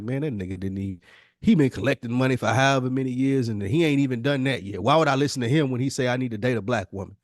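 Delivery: normal talking voice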